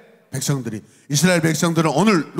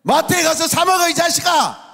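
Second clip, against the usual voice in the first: much higher, loud exasperated yell; scolding parent; 12 semitones higher, 5 dB louder than usual. Acted. Parent sends thirsty child to the mart, angry yell. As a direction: scold the kid at full pitch.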